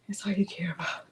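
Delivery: soft unvoiced whisper to the dog; teasing whisper. Real, not acted.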